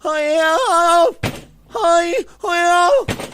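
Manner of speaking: loud, high staccato fake moans; hammed-up moans, as if being jolted; mock moaning. Imitating the neighbor heard through the wall, exaggerated moaning. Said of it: "she's moaning the whole way".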